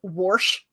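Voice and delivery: "warsh" with an r slipped in before the sh; Appalachian warsh. Shown as a demonstration. Showing friends grandma's Appalachian "warsh". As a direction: say "warsh", adding an r to wash. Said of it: "is a deep Appalachian thing".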